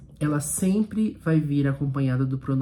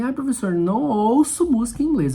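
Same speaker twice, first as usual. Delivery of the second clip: higher-pitched mock student protest; imitating a student; 6 semitones higher than usual. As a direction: play a pupil objecting, voice lifted well up. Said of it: "se você falar pra mim". (imitando aluno reclamando, em tom mais agudo)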